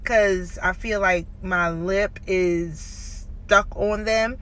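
lips catching on brackets; careful lip movement; talking with braces. Real, not acted. Seen 1: Metal brackets visible on teeth in many frames while talking.

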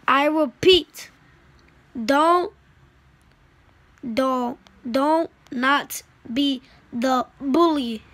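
loud, slow angry repeat; mad shout. Real, not acted. Kid repeating his warning to bullies, slow furious shouting. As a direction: drag it out, furious.

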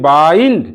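বাইন্ড stretched as "baa-eend"; long আই sound. Shown as a demonstration. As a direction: glide up through the আই in বাইন্ড, then drop. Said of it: "আই কথাটা যেমন আছে".

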